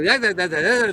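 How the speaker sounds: ordinary delivery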